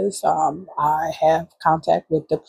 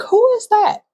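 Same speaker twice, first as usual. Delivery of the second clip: startled. Retold or imitated. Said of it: "And I was like".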